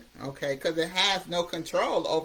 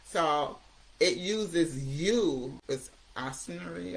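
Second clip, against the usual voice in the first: usual speaking voice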